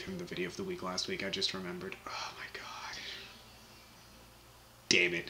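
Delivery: hushed oh my god at his own slip; embarrassed whisper. Real, not acted. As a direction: whisper it to yourself in dismay. Realizes he repeated the winner.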